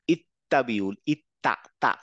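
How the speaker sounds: ইত্তাবিউল with a clear open তা; Arabic word demo. Shown as a demonstration. Teacher models an Arabic word for class, open তা. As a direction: say ইত্তা slowly, mouth opening on তা. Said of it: "এই মুখটা খুলে যাচ্ছে".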